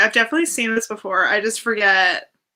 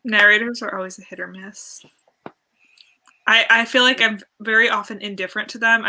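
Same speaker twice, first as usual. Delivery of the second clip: usual speaking voice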